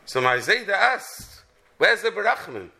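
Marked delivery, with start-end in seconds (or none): none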